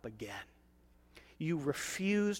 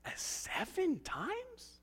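high, slow, disbelieving rise on times; mock amazed asking; incredulous question; 4 semitones higher, 7 dB quieter, much slower than usual. Disciple asking, amazed, in a high drawn-out voice.

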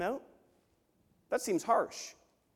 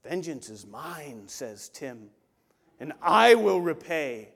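mock grand pronouncement; 4 semitones lower, 5 dB louder, slower than usual. Joking misquote as Tim.

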